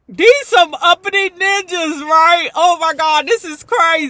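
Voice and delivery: excited reaction. Real, not acted. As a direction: gasp at the scene.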